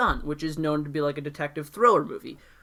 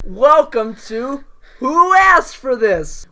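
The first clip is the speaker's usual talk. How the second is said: slow, louder show-host opening; podcast intro; 7 semitones higher, 9 dB louder, slower than usual. Acted. Host opening the podcast episode, announcer style.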